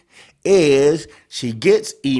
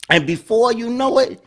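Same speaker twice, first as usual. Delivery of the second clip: ordinary delivery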